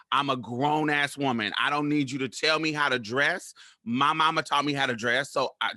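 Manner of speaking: ordinary delivery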